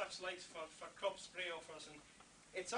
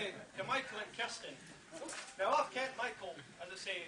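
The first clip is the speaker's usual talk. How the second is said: usual speaking voice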